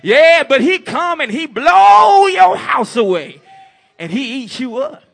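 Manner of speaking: loud, high, shouted sermon; fiery preacher; preaching at full force. Acted. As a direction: drive the message home to the congregation.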